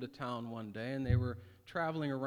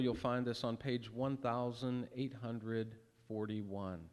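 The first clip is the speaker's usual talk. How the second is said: usual speaking voice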